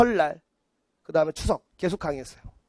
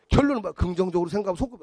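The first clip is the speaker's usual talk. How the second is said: ordinary talk, nothing marked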